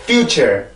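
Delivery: "future" ending in r-coloured -cher; careful "future" with supported -cher; correct English pronunciation. Shown as a demonstration. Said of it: "이렇게 해서 발음을 해줘야 되죠".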